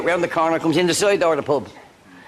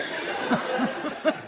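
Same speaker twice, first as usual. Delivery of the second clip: laughing; 10 semitones higher, 7 dB quieter than usual. Real, not acted. Laughs at own trick.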